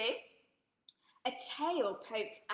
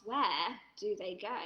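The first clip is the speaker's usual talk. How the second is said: usual speaking voice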